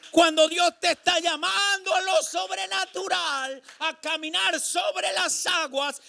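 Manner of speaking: loud, high, slow sermon shouting; drawn-out preacher's cries; fiery preaching. Acted. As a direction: preach it at full force to the church. Preaching at full force.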